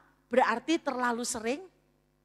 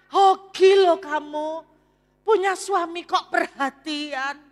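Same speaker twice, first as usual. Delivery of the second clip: loud, slow, gushing envious friend; a jealous friend gushing, much louder; 4 semitones higher, 8 dB louder, slower than usual. Retold or imitated. Friend envies the wife's doting husband, loud and gushing. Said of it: "Anda tahu komentar temannya".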